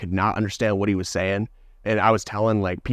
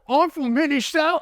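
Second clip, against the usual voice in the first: high, mocking imitation of the offended guy; mocking English guy. Retelling the offended worker's reply, mock high voice. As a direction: parody the man parodying him. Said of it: "he's like".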